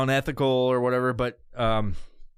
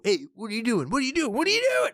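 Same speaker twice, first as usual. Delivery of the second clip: rising, higher each time, mock nagging; imitating nagging friends; 10 semitones higher, faster than usual. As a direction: pester him, climbing higher. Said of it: "They wanna just fucking go".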